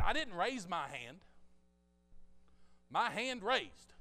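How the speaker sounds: loud, high retold protest, slowing at the end; baffled protest. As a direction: protest loudly, as if the hand acted alone. Retold protest about raised hand.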